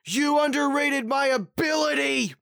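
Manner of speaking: high, loud, shouted bad-dub villain line; meme dub impression. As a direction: ham up the badly dubbed villain. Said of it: "this meme".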